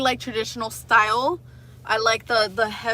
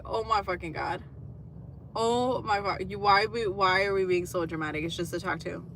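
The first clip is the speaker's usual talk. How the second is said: weary exasperation; 2 semitones lower, 6 dB quieter than usual. Retold or imitated. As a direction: sigh it out, done with this. Exasperated inner reply to stranger.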